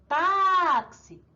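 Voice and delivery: táxi with TÁ long, high and loud; stressed penultimate syllable. Shown as a demonstration. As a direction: stretch and punch the TÁ in "táxi". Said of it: "sílaba mais forte é a penúltima".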